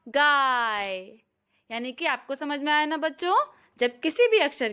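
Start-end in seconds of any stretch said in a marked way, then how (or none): 0.1-1.0 गाय with a drawn-out आ; long aa. Shown as a demonstration. Teacher blending a word for children, long aa. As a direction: stretch the गा of गाय. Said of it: "अब एक साथ बोलेंगे".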